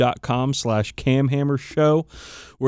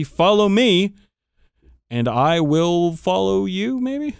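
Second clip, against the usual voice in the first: mock promise; 7 semitones higher, slower than usual. Jokey promise to followers.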